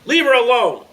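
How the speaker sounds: high, very loud protective shout; full-force furious shout; angry yell. A narrator's reading. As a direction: yell in anger to defend a sister. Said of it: "yelled at the man holding the weapon".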